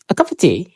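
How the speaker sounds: "A cup of tea" run together as "a kapa tea"; soft, fused "cup of" said as "kapa"; native linking. Shown as a demonstration. Teacher models the natural linked sound. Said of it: "kalau terbiasa dengan sound".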